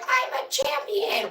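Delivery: slow, put-on boasting voice; mock champion. Host mocking a fighter who calls herself champion, put-on voice. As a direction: stretch it out in a mocking imitation. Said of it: "You out there just being like".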